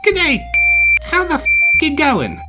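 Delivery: high, loud imitation Australian; sounding like an Aussie. Small engine tries again, casual imitation Australian. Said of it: "Now you're sounding like an Aussie".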